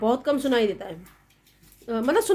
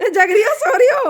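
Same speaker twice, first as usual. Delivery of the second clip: higher and louder, calling into the room; loud wake-up call; 8 semitones higher, 7 dB louder than usual. Real, not acted. Daughter-in-law rousing her sleeping mother-in-law, loud call. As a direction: raise your voice to rouse a sleeper.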